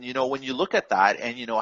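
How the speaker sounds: ordinary talk, nothing marked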